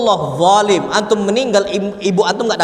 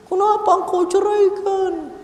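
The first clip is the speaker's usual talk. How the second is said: high, drawn-out imitation wail; mock crying; 11 semitones higher, slower than usual. Divorced wife pleading with her husband, hammed-up crying. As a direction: imitate a tearful wife, slow and high-pitched.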